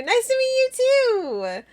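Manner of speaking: very high, squeaky playful greeting; sing-song squeak of a hello; high-pitched greeting. Acted. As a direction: pitch it way up, held on a high note.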